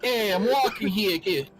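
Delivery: high, slow New York bark; mock New Yorker. Friend joking a New York line, mock New York accent. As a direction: do the cabbie yelling at a kid.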